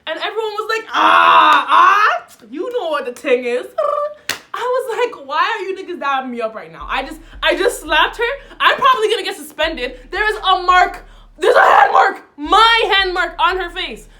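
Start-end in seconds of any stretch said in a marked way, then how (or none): 0.9-3.7 loud, high excited whoops; hype crowd cheer. Retold or imitated. Classmates cheering the slap. 5.3-14.1 loud, higher laughing shout; excited disbelief. Retold or imitated. Retelling shouting at hyping class.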